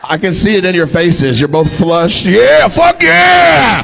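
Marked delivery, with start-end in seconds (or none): 2.3-3.8 high, drawn-out shouted cheer; hammed-up party yell; mock drunk cheer. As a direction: whoop it slowly like a rowdy drunk. Mocking drunk cousins cheering.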